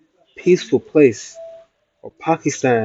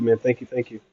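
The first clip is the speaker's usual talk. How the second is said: ordinary talk, nothing marked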